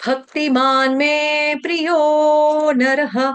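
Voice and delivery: भक्तिमान में प्रियो नरः chanted very slowly; slow recitation. Shown as a demonstration. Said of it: "वो कैसे पढ़ लेगा".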